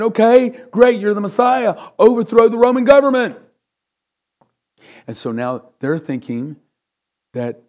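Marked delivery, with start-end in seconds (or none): 0.0-3.4 loud, high, eager shout of the crowd; crowd cheering. Acted. Crowd hailing Jesus as their Messiah, loud excited shout. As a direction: cry it out like a hopeful mob. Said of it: "And so now they're saying".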